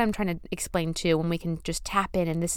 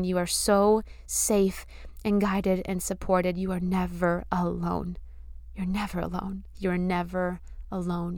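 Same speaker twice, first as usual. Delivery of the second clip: slow, softer reassurance, sinking quieter; deep and emotional; 4 dB quieter than usual. Real, not acted. Podcaster reassuring her listeners, slow and softening. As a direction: tell the listener tenderly they are safe. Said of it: "letting me get deep and emotional there".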